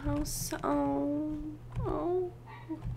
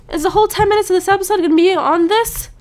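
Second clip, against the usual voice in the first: fast, loud exasperated rant; 5 semitones higher, 7 dB louder, much faster than usual. Real, not acted. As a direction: complain in a rush. Exasperated gamer.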